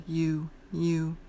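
"U" with lips well rounded, long oo. Shown as a demonstration. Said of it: "round your lips more".

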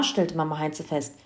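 plain reading voice

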